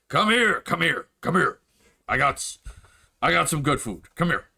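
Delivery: eager, calling the party over; lightly voiced orc barkeep beckoning. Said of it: "the large orcish gentleman behind the bar is just like".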